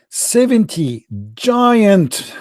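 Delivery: ominous storyteller emphasis; dramatic build-up. Acted. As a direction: draw out "Seventy giant" for suspense. Dramatic reading of the dragons.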